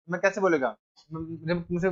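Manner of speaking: usual speaking voice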